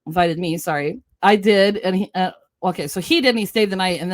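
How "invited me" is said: ordinary talk, nothing marked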